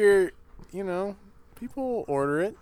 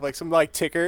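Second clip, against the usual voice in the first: usual speaking voice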